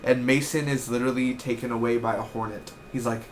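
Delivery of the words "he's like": ordinary delivery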